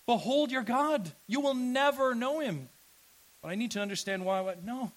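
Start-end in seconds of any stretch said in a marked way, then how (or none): 0.0-2.7 loud, high-pitched preaching; forceful proclamation. Real, not acted. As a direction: proclaim it with full pulpit force. Preacher proclaims God at full force.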